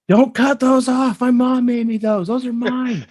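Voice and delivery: high, loud, drawn-out protest; re-enacted yelling. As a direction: yell it like an upset kid defending treasured clothes. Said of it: "I was yelling at 'em".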